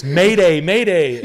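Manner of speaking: loud, high radio distress call; mock mayday call. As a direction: yell mayday into the radio, hammed up. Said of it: "I'm like this".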